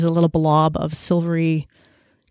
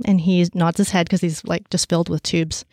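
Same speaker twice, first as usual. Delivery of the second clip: ordinary talk, nothing marked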